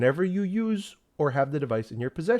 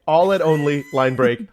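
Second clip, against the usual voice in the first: ordinary delivery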